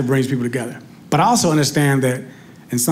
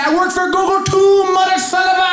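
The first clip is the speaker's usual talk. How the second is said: much higher, louder furious Indian agent; angry Indian accent; 14 semitones higher, 4 dB louder than usual. Retold or imitated. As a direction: yell back at the caller in an Indian accent. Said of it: "He said".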